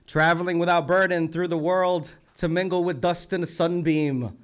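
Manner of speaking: loud, lifted poetry reading; poem recital. Acted. Host reading a poet's line to the room, declaimed.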